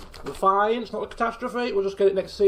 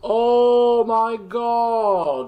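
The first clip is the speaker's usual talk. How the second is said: stretched, slow loud Oh my god; drawn-out oh my god; 5 dB louder, much slower than usual. Real, not acted. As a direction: drag it out in dismay. Fish mistake realised.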